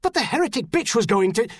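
fast, high excuse, cut short; quick, agitated self-defence; protesting. A narrator's reading. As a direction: protest hurriedly to an angry superior. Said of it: "the trooper began to protest".

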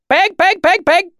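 high, loud, repeated pig call; herding call. A narrator's reading. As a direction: summon the herd in quick, high bursts. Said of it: "A voice came through the trees".

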